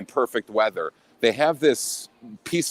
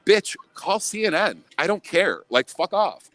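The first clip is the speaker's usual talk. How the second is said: retold defiant brush-off; 7 semitones higher than usual. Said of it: "I go".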